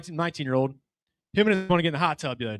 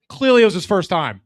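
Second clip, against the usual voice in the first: ordinary delivery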